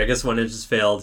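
ordinary delivery

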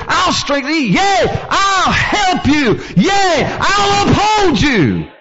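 exultant shouting preacher; cheering. Acted. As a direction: cheer it out like a rallying cry. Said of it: "it's almost like He's cheering".

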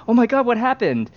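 a hint of a startled mother; alarmed mom. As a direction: imitate a mom rushing out in shock. Sitter's mom rushing out.

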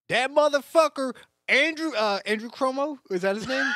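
imitating a Texan griping about Cuomo; mock Texan voice. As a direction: sneer it like an angry Texan. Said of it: "what the Texans are saying".

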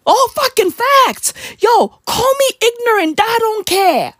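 high-pitched, emphatic defiance; comic squeal of defiance; defiant outburst. Real, not acted. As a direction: throw it out high and unbothered.

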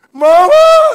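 loud, high yell of "Mama!"; screaming for mama. Retold or imitated. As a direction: cry out for mama like a grown man in trouble. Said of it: "the first thing we scream is".

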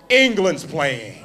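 very slow drawn-out excuse; mock excuse. Brother cancelling a date for football, slow mock excuse. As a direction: stretch it like a lame excuse.

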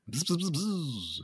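soft, high buzzing noise; buzzing drill imitation. Cable guy commentator mimics drilling through walls, buzzing drill imitation. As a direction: imitate a small drill with your mouth.

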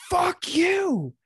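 loud, high-pitched outburst, imitating mom; angry snap. Fed-up mother turns on her nagging son, imitated angry snap. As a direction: explode at the pestering kid in mock fury. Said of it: "she snapped, turned around and goes".

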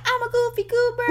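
squeaky SpongeBob-style mimicry with a laugh; cartoon imitation.

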